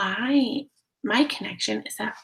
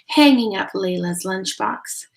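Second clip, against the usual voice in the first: plain reading voice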